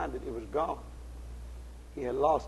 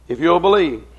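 loud, insistent preacher's repeat; emphatic appeal; 12 dB louder than usual. Real, not acted. Preacher urging the congregation toward heaven, loud emphatic appeal. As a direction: drive the appeal home loudly.

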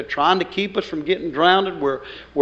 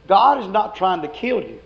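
normal talking voice

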